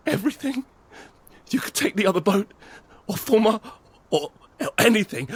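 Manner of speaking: high, pleading offer of everything; frantic begging. Acted. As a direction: beg with a rising, desperate voice. Man begging woman for help.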